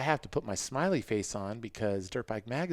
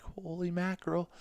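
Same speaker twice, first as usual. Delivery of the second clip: pitched way up in disbelief; high shocked holy mackerel; stunned exclamation; 9 semitones higher than usual. Retold or imitated. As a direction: gasp it out like the bike just snapped in half. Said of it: "I'm like".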